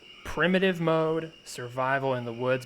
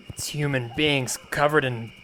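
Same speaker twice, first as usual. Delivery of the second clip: ordinary delivery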